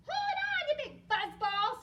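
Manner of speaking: high, loud shout of glee; excited call. Acted. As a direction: call out excitedly as the rocket lifts off. Story hero calls out at take-off.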